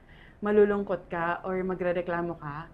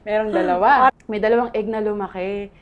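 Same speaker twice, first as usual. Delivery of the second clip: louder, laughing through the words; laughing along with the host; 3 semitones higher, 9 dB louder than usual. Real, not acted. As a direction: chuckle it out. Repeating the joke laughing.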